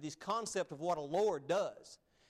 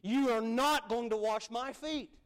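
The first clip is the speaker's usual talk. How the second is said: higher, firm, slowed refusal; emphatic refusal; 6 semitones higher, 4 dB louder than usual. Acted. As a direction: stand your ground, stress NOT. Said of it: "he was emphatic about it".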